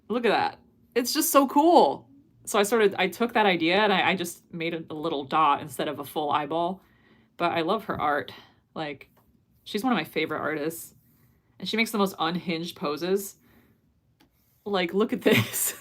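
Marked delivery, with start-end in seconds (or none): 0.1-2.1 high, loud and slow with delight; gushing excitement. Real, not acted. Gushing over artist's drawing. 14.7-15.8 laughing through the words. Real, not acted. Laughing at unhinged poses.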